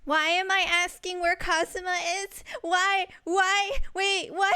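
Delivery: loud, high disbelief; incredulous yelling at the game; baffled outburst. Real, not acted. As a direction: shout it in utter bewilderment. Streamer baffled by own character's question.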